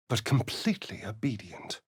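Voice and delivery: breathy whisper. Acted. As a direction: let it drop to a whisper. Whispered line.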